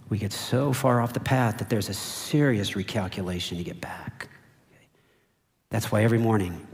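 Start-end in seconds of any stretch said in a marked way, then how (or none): 0.1-4.3 whisper. Real, not acted. Sermon point dropped to hush.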